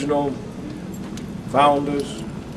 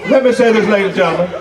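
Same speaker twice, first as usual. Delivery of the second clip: higher, calling the room to order; raised voice for order; 7 semitones higher, faster than usual. Real, not acted. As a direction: demand the room's attention firmly. Chair shouting down disruption at meeting.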